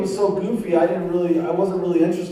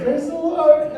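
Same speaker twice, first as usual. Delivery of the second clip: much higher, sing-song praise; parrot-like sister; 11 semitones higher, 3 dB louder, slower than usual. Retold or imitated. Mocking his born-again sister chirping praise like a parrot. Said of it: "She sounded like a parrot".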